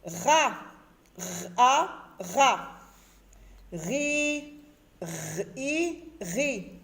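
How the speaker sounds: each syllable sounded alone, slowly, with long vowels; teacher drilling R plus vowel, separated by pauses; reading out syllables. Shown as a demonstration. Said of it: "Je lis des syllabes". (lire des syllabes isolées, en allongeant les voyelles)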